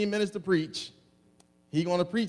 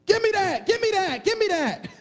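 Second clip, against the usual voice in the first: much higher and louder, demanding shout; mock yelling child; 13 semitones higher, 6 dB louder than usual. Pastor imitating someone yelling demands at their father, mock shout. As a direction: shout it like a greedy child begging. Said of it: "I've never yelled at my daddy".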